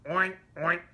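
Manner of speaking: loud, high pig oinks; playful oink oink. Acted. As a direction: oink like two pigs. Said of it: "said Pig and Pig".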